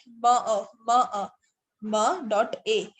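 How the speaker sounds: म अ broken into bare "ma, a"; wrong letter sounds. Shown as a demonstration. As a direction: sound out म and अ separately, no vowel before the m. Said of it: "ऐसे तो नहीं बोलते ना".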